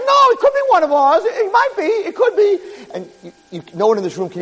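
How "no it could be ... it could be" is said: high, breathless hope; hopeful good angel. Acted. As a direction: insist it might be ours. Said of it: "the good angel's like".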